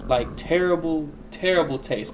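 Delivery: normal talking voice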